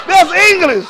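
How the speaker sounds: high, loud, laughing protest; laughing disbelief. Retold or imitated. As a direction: crack up while calling out the nonsense. Retold friends reacting in disbelief.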